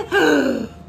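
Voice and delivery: sharp storyteller's gasp; dramatic gasp. Acted. Reader warns children of coming crash, dramatic gasp. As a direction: suck in air in suspense. Reader reacts to monkeys' rudeness.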